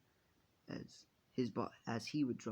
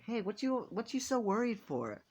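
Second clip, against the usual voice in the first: much higher, louder character voice; cheerful friend's call; 11 semitones higher, 6 dB louder than usual. Acted. Friend surprising the panicking hero from behind, high playful call.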